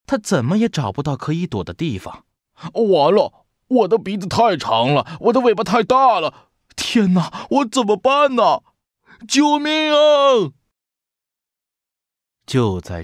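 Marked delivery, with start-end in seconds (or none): 2.7-10.5 high, loud panic building to a scream; frantic storybook big brother in danger; panicked cries. A narrator's reading. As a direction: wail in terror, ending in a long cry for help. Cornered by leopard, cries for help.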